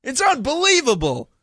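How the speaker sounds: high-pitched mock grievance; indignant whine. Moviegoer complaining his seat was taken, whiny outrage. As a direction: protest like a hard-done-by man. Comic rant about lost seat.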